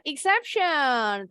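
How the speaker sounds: ordinary talk, nothing marked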